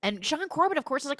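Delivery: ordinary talk, nothing marked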